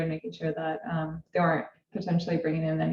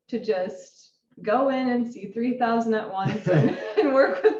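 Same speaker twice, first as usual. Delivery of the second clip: louder, laughter breaking into the words; laughing while talking; 4 semitones higher, 8 dB louder than usual. Real, not acted. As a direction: recount your own bad idea through laughter. Laughing at own overambitious plan.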